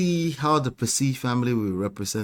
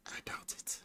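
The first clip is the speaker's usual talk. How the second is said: barely audible whisper; doubtful whisper; 22 dB quieter than usual. Real, not acted. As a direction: whisper it under the breath. Sceptical remark repeated under breath.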